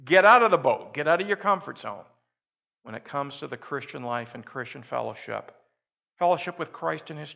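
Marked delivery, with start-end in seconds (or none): 0.1-2.1 much louder, higher shout of Get out of the boat; a ringing shout to step out; loud call. Acted. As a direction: call it out like a challenge. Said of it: "you'll need to hear the words".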